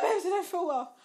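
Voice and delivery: hammed-up poorly whine with a laugh in it; mock feeble whine. Sick girlfriend telling boyfriend she feels unwell, mock whining plea.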